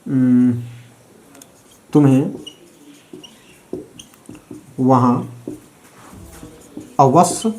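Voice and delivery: each word spaced with long pauses; slow dictation. Shown as a demonstration. Teacher dictating an example sentence, slow and spaced. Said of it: "कोई sentence बोलिएगा तो example में".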